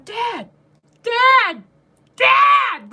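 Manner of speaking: yelling for a husband who won't answer; grandma yelling. Retold or imitated. Grandma shouting at grandpa right behind his head, imitated yell. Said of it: "like, as loud as she can".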